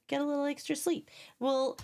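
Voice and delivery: ordinary talk, nothing marked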